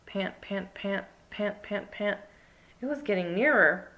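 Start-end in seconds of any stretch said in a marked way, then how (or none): 0.1-2.2 soft panting. A narrator's reading. Panting noise.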